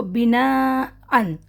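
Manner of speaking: slow, drawn recitation of بنا ان; Quran recitation demo. Shown as a demonstration. Teacher repeating بنا ان to students, slowly. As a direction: recite بنا ان with the nun sounded at the end. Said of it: "دیکھیں".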